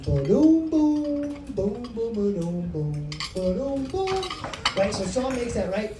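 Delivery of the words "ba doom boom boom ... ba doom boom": beatbox the rhythm with your mouth. Retold or imitated. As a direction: mouth beat, boom ba-doom. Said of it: "play a beat that goes boom".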